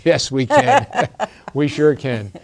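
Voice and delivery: laughing through the words; real laughter after the chant ends. Real, not acted. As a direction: laugh as you say it.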